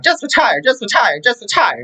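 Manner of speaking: high mock nagging repeated plea; hammed-up husband begging her to retire; imitating dad. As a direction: pester her like a fed-up husband. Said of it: "My dad's like".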